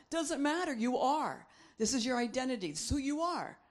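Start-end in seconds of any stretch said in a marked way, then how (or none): none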